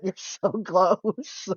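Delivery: slow, breaking into a laugh mid-line; laughing encouragement. Retold or imitated. To mom after she half gets it right, laughing. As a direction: tease mom gently while giggling. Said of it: "And I was like".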